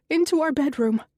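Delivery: high, loud urgent order; demanding. Acted. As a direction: push them along with a loud command. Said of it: "Connie demands".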